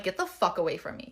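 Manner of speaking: ordinary delivery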